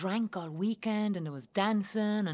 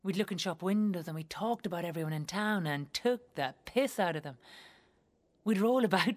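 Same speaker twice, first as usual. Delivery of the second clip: ordinary talk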